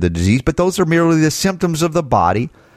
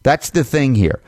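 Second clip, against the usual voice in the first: ordinary talk, nothing marked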